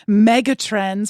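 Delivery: slow, drawn-out mock sportscaster. Host mocking a buzzword she avoids, sportscaster-style. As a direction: stretch it out like a big event announcer. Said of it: "sounds like I should have one of those sportscasters".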